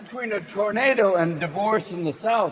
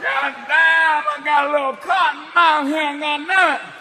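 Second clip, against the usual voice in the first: stoned horse; 7 semitones higher than usual. Retold or imitated. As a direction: whine about cotton mouth. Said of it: "the horse will be going".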